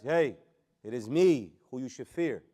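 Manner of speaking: slow, loud, weighty stress on "me"; emphasis on myself. Acted. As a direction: lean hard on "me" and stretch it out. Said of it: "I'm putting the emphasis on myself".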